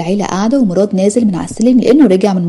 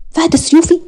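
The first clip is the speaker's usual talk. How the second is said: mocking disbelief; 9 semitones higher than usual. Wife mocking husband's jealousy.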